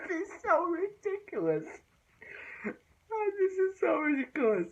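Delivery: high squeaky laugh through the words; can't get it out for laughing; cracking up at the ridiculous line. Real, not acted. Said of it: "such a ridiculous quote".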